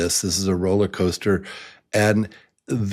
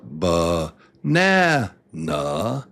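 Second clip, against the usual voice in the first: ba-NA-na in separate beats; build up to stress; 2 semitones lower, much slower than usual. Shown as a demonstration. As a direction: climb to the middle na, then drop. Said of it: "you either build it up".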